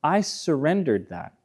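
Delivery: a gentle, peaceful confession; soft surrender. Retold or imitated. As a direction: let go quietly and sincerely. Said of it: "The vulnerability says".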